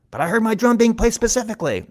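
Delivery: high, loud, rushed protest; insistent cat. A narrator's reading. Cat insists to Mouse he heard drumming, high fast protest. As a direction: blurt it out in squeaky insistence.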